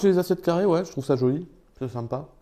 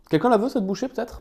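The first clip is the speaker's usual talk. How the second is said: playful high offer; 10 semitones higher, 5 dB louder than usual. Acted. Offering the bite teasingly to viewers.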